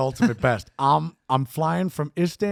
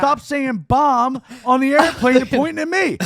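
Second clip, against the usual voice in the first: retold yell; 9 semitones higher, 8 dB louder than usual. Bam yelling back at fan.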